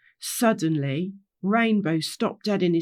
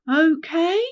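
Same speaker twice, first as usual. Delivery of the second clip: high, rising, louder check; prompting; 8 semitones higher, 5 dB louder than usual. Acted. Rainbow asking the gang to agree, insistent rising prompt. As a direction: press the group for an answer.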